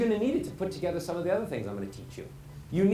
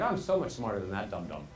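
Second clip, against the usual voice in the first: low, soft, smug aside; dismissive listener; 10 semitones lower, 5 dB quieter than usual. Retold or imitated. Bored listener scorning the predictable speaker, low and dismissive. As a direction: write the speaker off under your breath. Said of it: "you stop listening 'cause you're like".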